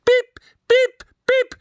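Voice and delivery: very high beep, beep, beep; squeaky electronic beeps; game beeps. Retold or imitated. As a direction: play the old computer tennis game. Imitating block tennis game sound.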